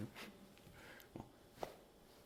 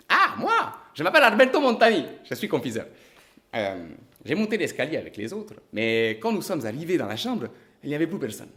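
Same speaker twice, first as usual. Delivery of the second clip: high, fast, bursting confectioner; an Italian shopkeeper eager to tell his part; high-pitched chatty witness blurting his name; 4 semitones higher, 9 dB louder than usual. Acted.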